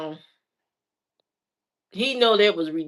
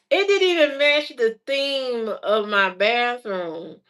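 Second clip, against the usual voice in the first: normal talking voice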